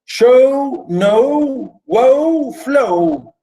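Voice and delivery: "show, no, woe, flow" each held long on a high pitch; stressing the rhymes. Shown as a demonstration. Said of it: "A, B".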